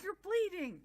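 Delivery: mock panicked cry, pitched high; retold flip-out. She flips out at him bleeding, mock panicked. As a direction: blurt it high in alarm. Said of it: "She kind of flips out".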